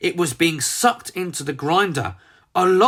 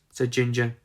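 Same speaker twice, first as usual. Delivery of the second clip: ordinary delivery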